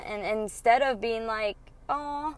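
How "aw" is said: mock sweet apology. Mock apologetic reply she rejects.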